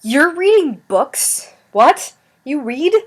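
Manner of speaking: slow, high, drawn-out disbelief; mock shock. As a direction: tease them with exaggerated shock. Teasing friend who reads books.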